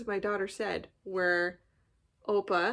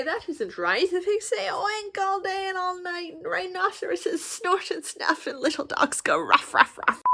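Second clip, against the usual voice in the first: unhinged voice; 9 semitones higher, 6 dB louder than usual. Shown as a demonstration. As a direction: read it like you're losing your mind. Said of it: "in a more and more unhinged voice".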